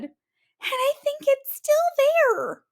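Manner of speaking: very high, squeaky cub voice. Acted. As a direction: squeak it in alarm.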